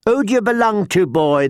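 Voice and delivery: quick, high-pitched interrogation; demanding. A narrator's reading. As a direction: fire the question like a scolding guardian. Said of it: "the Septa demanded".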